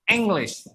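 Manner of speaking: "English" starting with an open E, eng-lis; E-initial English. Shown as a demonstration. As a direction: say "English" with eh as the first sound. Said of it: "Bukan English".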